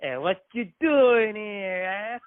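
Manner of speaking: high, slow mock New York accent; exaggerated New York challenge. Shown as a demonstration. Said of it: "New York, it's like".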